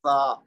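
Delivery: سا with the tongue tip between the teeth; the th-like ث sound of سا held briefly; demonstrated sound. Shown as a demonstration. Teacher shows his students how سا should sound. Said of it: "کیسے؟".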